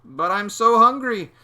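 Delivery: high, loud, slow whine; loud childish whining; whiny hungry boy. A narrator's reading. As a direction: plead for lunch like a child. Boy whines about lunch.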